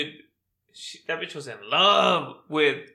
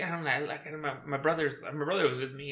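ordinary talk, nothing marked

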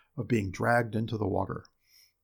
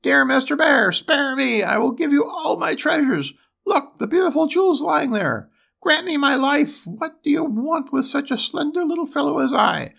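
very high, pleading dwarf voice; dread-filled cry; 12 semitones higher, 4 dB louder than usual. A narrator's reading. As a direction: beg for your life in a loud, squeaky dwarf voice. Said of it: "in the dread of his heart, he cried".

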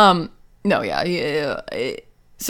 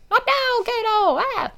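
very high, strained mock cry; hammed-up high-pitched protest; Clouseau. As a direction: squeal it as if under sudden attack. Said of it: "So Clouseau would be like".

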